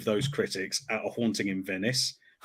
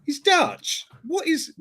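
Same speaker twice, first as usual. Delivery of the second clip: very high, incredulous protest; mock disbelief; slower than usual. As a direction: pitch it way up in comic bafflement. Acts out indignant bafflement at discovery.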